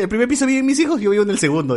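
high, bragging retelling of dad; quoting a proud dad. Retold or imitated.

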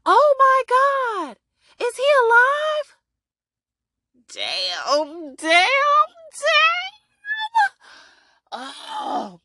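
stunned fan squealing at the cliffhanger; shocked outburst. Real, not acted. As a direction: cry out at the screen in shock.

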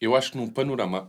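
usual speaking voice

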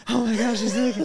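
high, giggly shocked whisper-yell; amazed gasp. Retold or imitated. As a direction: act wide-eyed teen shock. Teen self shocked at figure.

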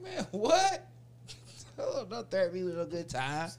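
squealing high laugh of surprise; delighted disbelief. Retold or imitated. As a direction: shriek with glee at the deal. Hears buy one get one.

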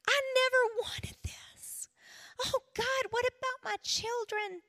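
high, slow, crying whisper; tearful whisper. Retold or imitated. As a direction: break down whispering to God. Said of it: "I cried out to the Lord".

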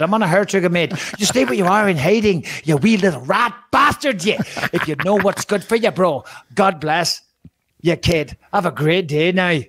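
hammed-up Northern Irish accent; sling insults in a put-on Northern Irish accent; Conor McGregor. Host as McGregor sees off a rival, mock Northern Irish accent. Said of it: "it's the only Irish accent that I can do".

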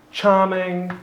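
ordinary delivery

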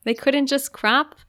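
ordinary talk

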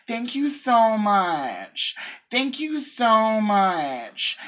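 high, drawn-out imitation of effusive thanks; Gia Gunn-like gushing. Cashier mimicking customer's repeated thanks, over-effusive and drawn out. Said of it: "it was also the way she was".

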